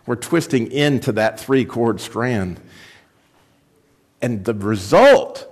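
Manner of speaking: ordinary talk, nothing marked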